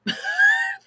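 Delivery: short, high-pitched laugh; high burst of real laughter. Real, not acted. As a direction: let out a quick, high laugh. Laughs at being corrected.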